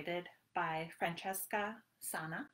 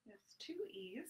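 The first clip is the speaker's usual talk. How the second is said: whisper; 10 dB quieter than usual. Acted. Pocket search.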